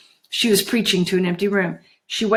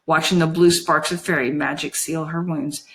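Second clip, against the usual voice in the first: usual speaking voice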